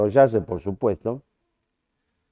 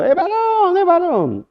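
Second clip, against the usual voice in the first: much higher, cried out like a doctor announcing the birth; retold excited shout; slower than usual. Doctor bursting out of surgery announcing it's a boy, high excited shout. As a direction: shout the news to the whole hospital.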